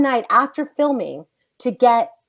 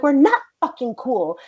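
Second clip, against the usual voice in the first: higher-pitched, indignant comeback; angry retort; 6 semitones higher than usual. Retold or imitated. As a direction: shut him down, re-enacting the argument. Acted quarrel with Josh.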